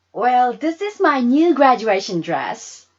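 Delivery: put-on loud, bright young girl showing off; proud girl. Sarah showing Wynonna her new dress, proud and loud.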